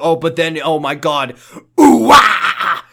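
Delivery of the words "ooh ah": loud high ooh-ah cry; hammed-up shout of realization; sudden loud exclamation; mock gasp. Acting Kyle's realization.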